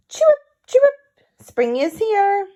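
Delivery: high, loud bird call, slowing on the words; tweeting songbird announcing spring; chirpy bird voice. Acted.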